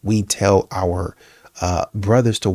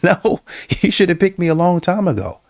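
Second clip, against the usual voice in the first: laughing refusal; 9 semitones higher than usual. Retold or imitated. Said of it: "You're like".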